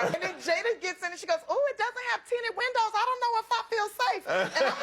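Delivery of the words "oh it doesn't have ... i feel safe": high-pitched imitation of a worried friend; comically precious, raised pitch; mock fussy friend. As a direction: fret in a put-on high voice. Comic retelling of friend's worried fussing.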